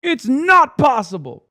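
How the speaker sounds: sudden high shout of a nihilistic douchebag; mock cynic shout. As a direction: bark it back like a furious cynic. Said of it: "nihilistic douchebag being like".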